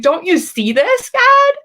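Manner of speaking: high, loud pleading cry to God; desperate plea. Retold or imitated. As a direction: beg God to see it. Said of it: "like, desperate".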